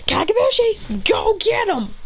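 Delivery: high-pitched battle command; attack call. Acted. Hero sending clones at students, high command. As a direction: call it out high, urging them on.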